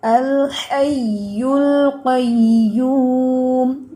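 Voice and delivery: الحی القیوم slow, doubled ya firm; slow Quranic recitation; recited with shadda. Shown as a demonstration. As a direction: lean into the shadda, drawn out. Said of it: "شد کو ذرا سختی سے پڑھنا ہے".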